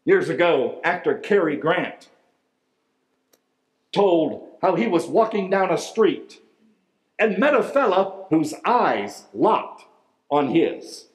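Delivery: ordinary talk, nothing marked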